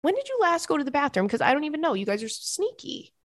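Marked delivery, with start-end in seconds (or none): 0.0-1.3 high, fast worried-owner questioning; fussing pet owner, pitched up; questioning the cats. Acted. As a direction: quiz the cats in a quick, high voice. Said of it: "we're always like".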